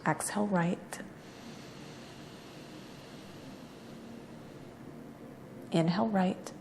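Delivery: soft, unhurried yoga-teacher cues; guided breathing meditation. Real, not acted. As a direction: guide each breath quietly, with long pauses between cues.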